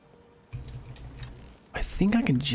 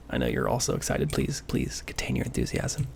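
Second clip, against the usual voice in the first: sarcastic plea; 11 semitones lower than usual. Acted. Sarcastic plea to excited chat.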